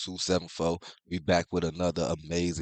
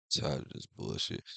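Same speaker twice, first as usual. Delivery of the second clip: fed-up whisper under his breath, much lower and softer; whispered annoyed mutter; 9 semitones lower, 10 dB quieter than usual. Real, not acted. Streamer muttering to himself about pop-up ads, whispered and fed up. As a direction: grumble it to yourself, barely voiced.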